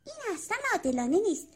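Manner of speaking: high-pitched aggrieved little caterpillar; self-pitying, put-out storybook caterpillar; indignant caterpillar. A narrator's reading. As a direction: grumble to yourself in a high, childlike creature voice. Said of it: "کرم با عصبانیت با خودش فکر کرد".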